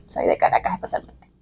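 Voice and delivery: susurro suelto, casi sin voz. Real, not acted. Aparte susurrado sobre su origen. (susurro juguetón)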